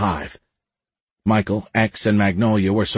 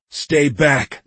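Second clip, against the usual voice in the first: higher, forceful warning; raised, urgent command; shouting; 6 semitones higher, 3 dB louder than usual. A narrator's reading. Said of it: "Michael shouted".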